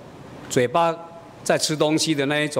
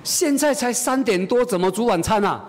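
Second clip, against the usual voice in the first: high-pitched mock protest, slowed at first; puzzled objection; 8 semitones higher than usual. Mother answering her child's early dinner demand, puzzled objection. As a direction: object in a raised, questioning voice.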